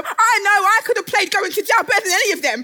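normal talking voice

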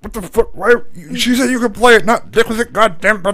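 high, sputtering mock dad outburst; comic tirade, stumbling into a loud finish; angry dad. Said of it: "I know how Dad's gonna respond".

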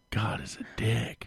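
soft unvoiced whisper; whispered aside. Real, not acted. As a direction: breathe it out as a joke.

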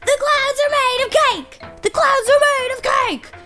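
excited yelling. Acted. Dad running down the street, excited yelling. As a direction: call it out to the whole street. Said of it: "everywhere he went, he yelled".

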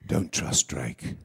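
low, very slow villain voice; Talbot. Acted. Game character giving a fan a message, low slow Talbot voice. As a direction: drop deep and warn them slowly. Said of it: "positive message in Talbot's voice".

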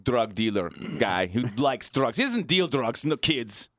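put-on light comic Russian accent; mock Russian accent. Host describing the show in mock Russian accent. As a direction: pitch the plot in a thin Russian accent. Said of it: "in Russian accent".

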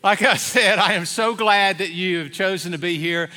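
ordinary talk

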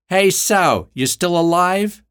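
high, loud mocking call to the back seat. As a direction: taunt the limp body cruelly. Woman taunts the bound captive.

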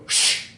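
sharp drawn shush; long hissed "shh". Acted. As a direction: shush dogs from over a fence. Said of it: "hear someone from over the fence go".

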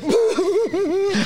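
high mock boo-hoo sobbing; imitated crying. Tough Mike in the jail waiting room, mock crying. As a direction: wail in exaggerated fake sobs.

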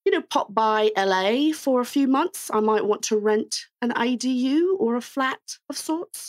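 put-on slow, posh-leaning British accent. Host asks for an ode to the Queen, slow British accent. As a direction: play the Queen's English, slowly. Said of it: "in your best British".

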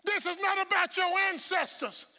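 high, shouted pulpit proclamation; full-force preaching. Real, not acted. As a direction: proclaim it with pauses between cries.

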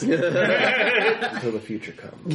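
slow, high, drawn-out oracle; mystic oracle voice. Acted. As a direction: intone it like a cryptic seer. Oracle answers again, slow.

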